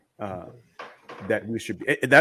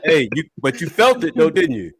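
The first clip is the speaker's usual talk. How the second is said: laughing; 5 semitones higher, 7 dB louder than usual. Real, not acted. Teasing through laughter.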